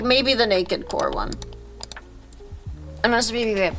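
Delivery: normal talking voice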